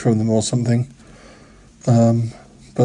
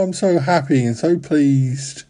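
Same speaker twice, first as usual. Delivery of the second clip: ordinary delivery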